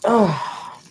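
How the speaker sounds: sudden high hiccup; a startled hiccup. Real, not acted. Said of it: "I got hiccups".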